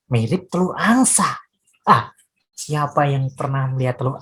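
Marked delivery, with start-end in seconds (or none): none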